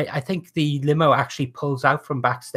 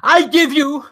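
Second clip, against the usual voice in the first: 19th century orator; 6 semitones higher, 3 dB louder than usual. Acted. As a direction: hold court like a lordly showman. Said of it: "Lord Monet promo continues".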